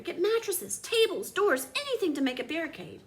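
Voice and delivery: high, urgent mayor's call; rally the town; shouting mayor. A narrator's reading. Mayor commanding townspeople against the pasta, urgent high shout. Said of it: "shouted the mayor".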